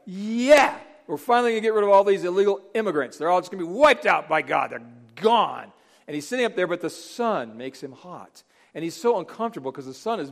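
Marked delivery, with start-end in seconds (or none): 0.1-5.7 gleeful mock cheer, higher and louder; excited Jonah. Jonah on the hill gloating at Nineveh, gleefully excited. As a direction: cheer on the coming destruction. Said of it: "He's excited".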